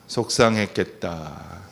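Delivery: slow, gentle "속상했겠다" drawn out softly; empathetic phrase demo. Shown as a demonstration. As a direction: say "속상했겠다" slowly like comforting a friend. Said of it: "우리 한번 따라해 보세요". (천천히 다정하게 "속상했겠다")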